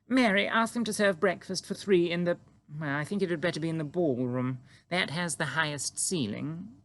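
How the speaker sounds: Queen greeting the giant calmly; composed Queen. A narrator's reading. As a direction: stay perfectly poised, royal. Queen voiced in story.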